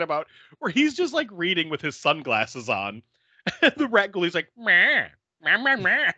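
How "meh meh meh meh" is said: high squeaky rat bleats; little rat noises. Pretend. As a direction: squeak like a tiny rat puppet. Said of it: "like, making little noises".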